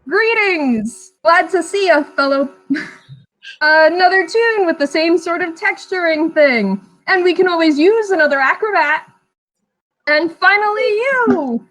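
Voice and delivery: high, bright ringmaster showman voice. Acted. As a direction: sell it like a circus ringmaster. Ringmaster excited over Chi-Chi.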